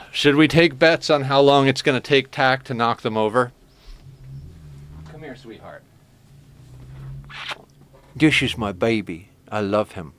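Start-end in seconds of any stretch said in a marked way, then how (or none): 5.1-10.1 coaxing the cat. Real, not acted. Calling the cat gently.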